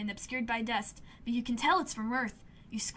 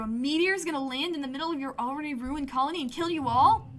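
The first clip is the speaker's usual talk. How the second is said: ordinary delivery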